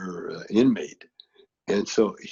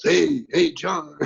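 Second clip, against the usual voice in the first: mock yell, raised and louder at first; retold yell; 5 semitones higher, 5 dB louder, much slower than usual. Jordan calls to his friend from outside the house, yelling. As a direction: call out as if from a car. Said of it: "and he yells".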